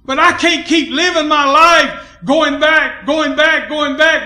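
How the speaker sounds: loud, high, emphatic pulpit delivery; full-force preaching. Real, not acted. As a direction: drive it home to the congregation.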